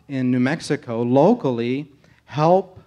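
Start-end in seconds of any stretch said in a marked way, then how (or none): none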